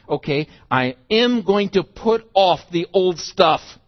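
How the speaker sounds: a resolute vow at full preaching volume; firm resolve. Acted. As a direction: proclaim it slowly and forcefully.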